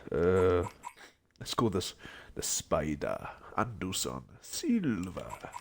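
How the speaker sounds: usual speaking voice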